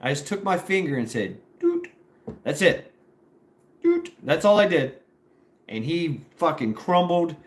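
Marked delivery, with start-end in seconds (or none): 1.6-1.9 very high short "Doot" squeak; poke sound. Retold or imitated. Sound of poking his jaw. 3.8-4.1 very high short "Doot" squeak; poke sound. Retold or imitated. Sound of poking his jaw.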